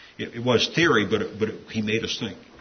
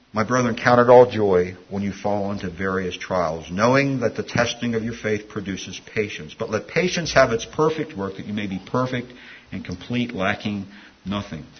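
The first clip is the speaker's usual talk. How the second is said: scripture reading; 4 dB louder than usual. Acted. Reads scripture aloud.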